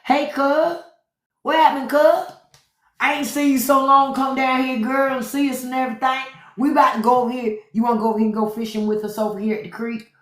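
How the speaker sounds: high-pitched, fast North Carolina country cousin; North Carolina accent. Acted. Inviting a cousin to go fishing at the creek, North Carolina accent. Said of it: "that's how I'm able to do that accent so good".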